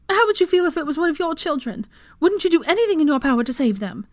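high, loud, desperate appeal; pleading. A narrator's reading. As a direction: argue frantically for your child. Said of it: "Molly tried again".

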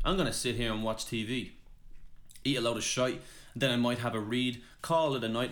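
rhythmic spoken-word delivery; poem recitation. Acted. Poet shares own lockdown poem, rhythmic recital. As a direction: land each rhyme with a steady beat.

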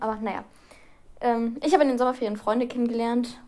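ordinary talk

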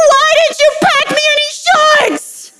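put-on high, loud, breathless kid through laughter; imitating a tearful boy; sobbing child. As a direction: whine it like a crying preschooler. Sobbing child still bargaining.

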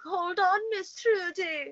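put-on a high, slow stab at an Irish accent. Telling the story of hanging on the pool slide, in a mock Irish accent. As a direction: hold on in a mock Irish lilt. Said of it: "in the Jamaican accent you just said it in".

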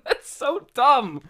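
slow, loud, laughing through it. Real, not acted. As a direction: let the laugh carry every word. Laughing at a silly line.

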